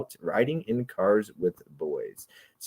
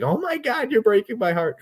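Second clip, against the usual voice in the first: much higher, whiny melodrama; mock heartbreak; 14 semitones higher than usual. Retelling Tim's reaction to the watch list, high melodramatic wail. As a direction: wail it like a wounded friend. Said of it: "you know how he was like".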